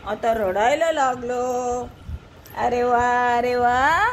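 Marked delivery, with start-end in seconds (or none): none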